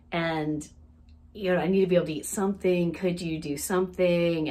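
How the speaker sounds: low-pitched, mildly humble plea; humble request. Retold or imitated. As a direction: retell a polite, careful request. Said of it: "she asks the waiter very humbly".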